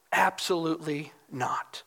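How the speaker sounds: hushed, low repeat with spaced words; emphatic denial. Retold or imitated. Preacher repeats John's denial, quiet and grave. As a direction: drop low and soft, letting it land. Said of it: "The emphatic shows".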